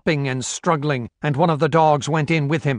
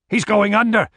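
raised-pitch alarm; 8 semitones higher, 4 dB louder than usual. Acted. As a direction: warn that a life is slipping away. Handler alarmed as dog sinks.

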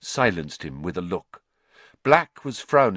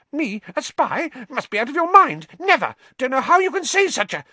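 high, loud, squeaky cry; shrill frightened rat-man squeal; 5 dB louder than usual. A narrator's reading. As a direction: squeal in terror. Pettigrew panicked denial.

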